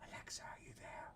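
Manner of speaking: very soft, voiceless whisper. Real, not acted. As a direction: whisper to see if it whispers back. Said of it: "How come you're not whispering back?".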